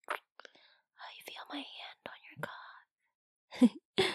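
soft breathy whisper, voiceless. Acted. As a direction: whisper it close to the ear. Intimate whispered teasing.

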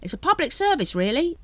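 high, lightly pious seller; pious hawker. A narrator's reading. Dibbler pitches to Vimes, lightly pious. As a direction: play the saint while selling. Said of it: "he added piously".

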